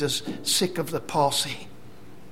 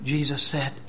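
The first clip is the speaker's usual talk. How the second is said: normal talking voice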